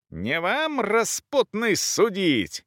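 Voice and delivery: high, scornful angry retort; outraged countess, high without full volume; shouting back. A narrator's reading. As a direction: cry the insult back, pitched high. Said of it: "кричала ей в ответ графиня".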